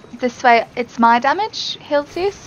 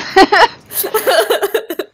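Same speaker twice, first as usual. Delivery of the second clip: unhinged villain cackle; maniacal laugh; 7 semitones higher than usual. Acted. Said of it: "That's my maniacal laugh".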